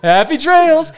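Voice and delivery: high laughing mock cheer; laughing sing-song. Mocking friend's cheery line.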